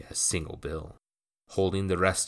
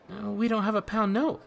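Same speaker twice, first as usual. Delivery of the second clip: put-on much higher, fast boyish voice; a startled lad blurting out; young boy Jacques. A narrator's reading. Jacques objects.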